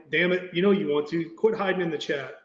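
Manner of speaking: ordinary talk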